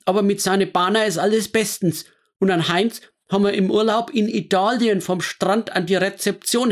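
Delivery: high, indignant Franconian woman griping; scolding. Acted. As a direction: huff at the gossip. Frau Haas reports, still indignant.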